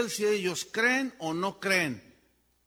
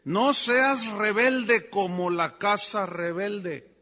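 slow solemn warning; 5 dB louder, much slower than usual. Acted. Quotes God's warning slowly.

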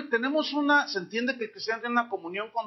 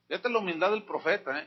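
usual speaking voice

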